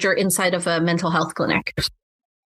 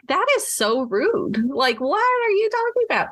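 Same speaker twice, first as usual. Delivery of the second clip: indignant disbelief; 10 semitones higher than usual. Retold or imitated. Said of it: "I was like".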